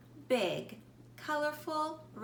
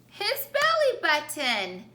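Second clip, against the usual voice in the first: excited reveal; 5 semitones higher, 7 dB louder than usual. Acted. Punchline reveal to children.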